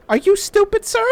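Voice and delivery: high, loud sneering insult; mock him with disdain; scornful. Inmate insulting guard.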